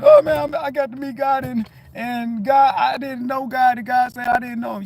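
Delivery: quoted believer. Retold or imitated. Retelling a person meeting God.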